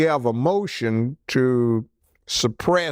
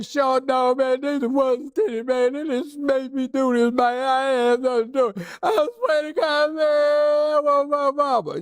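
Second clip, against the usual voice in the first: high, sobbing plea that breaks into tears; crying for mama; 11 semitones higher than usual. Retold or imitated. Tough guy breaks down to the judge, high and sobbing. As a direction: blubber like a kid caught out, begging. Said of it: "He crying for, he's crying for his mama".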